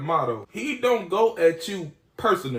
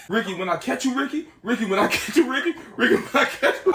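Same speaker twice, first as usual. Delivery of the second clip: raised, repeated comic threat, building higher; mock angry threat; 6 semitones higher, faster than usual. College Boy threatening the man with his mama, mock raging. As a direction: imitate a man too enraged to finish his threat. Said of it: "Old boy get mad as hell".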